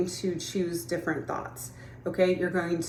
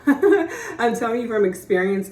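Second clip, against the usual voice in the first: laughing; 4 semitones higher, 5 dB louder than usual. Real, not acted. Laughs at own insistence.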